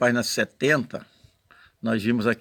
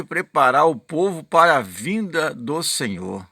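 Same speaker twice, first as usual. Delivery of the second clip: preaching read-aloud; 4 semitones higher, 5 dB louder than usual. Acted. Reads the prophet's text aloud.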